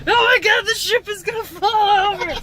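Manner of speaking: high startled cry; sudden alarm as the truck tips. Real, not acted. Truck lurching over speed bump.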